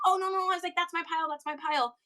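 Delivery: high-pitched retold objection; urgent protest. Seller stopping a couple going through their pile, urgent protest.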